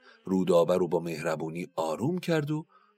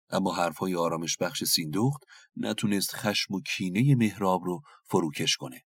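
plain reading voice

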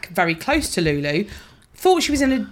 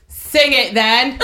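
slow, louder call from the audience; mock shouted heckle; 3 semitones higher, 5 dB louder, much slower than usual. [name] imitating Mum heckling Lulu, slow and loud. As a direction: bellow it at the stage, laughing. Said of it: "And Mum went".

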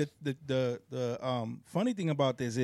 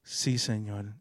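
put-on old school prayer murmur; 7 semitones lower, 4 dB quieter, slower than usual. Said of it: "you hear 'em going like".

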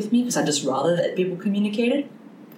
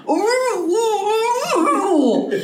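high mock moans of delight; playful moaning ooh; much slower than usual. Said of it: "mercy sounds more like".

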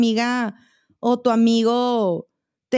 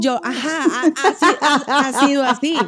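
laughing; 2 semitones higher than usual. Real, not acted. Laughing through her words.

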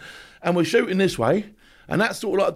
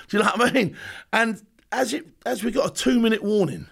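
normal talking voice

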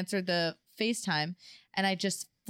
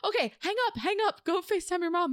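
high eager hang up; eager urging; 10 semitones higher than usual. Retold or imitated. Friends telling her to call mom, high eager urging. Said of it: "they're like".

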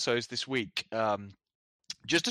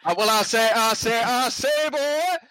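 high, slow blustering cartoon rooster drawl; a big Southern drawl, climbing high on "boy"; Foghorn Leghorn; 10 semitones higher, 6 dB louder, slower than usual. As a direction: stretch out every "say" like a pompous rooster. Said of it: "I sound like Foghorn Leghorn".

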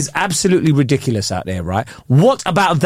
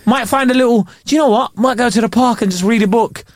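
higher, cheerful eager voice; eager to go outside; 6 semitones higher than usual. Pretend. Upbeat summer self voiced.

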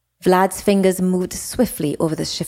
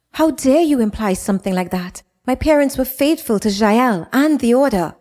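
offended outrage; 6 semitones higher than usual. Acted. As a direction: throw the accusation back at him. Jada offended by insinuation.

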